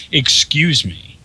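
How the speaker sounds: slow, stern "Excuse me" like a mother taking the mic; a hint of a stern mother demanding silence; mom voice. Mother seizing the headset to scold teenage gamers, mildly stern slow mom voice. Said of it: "she's got mom voice".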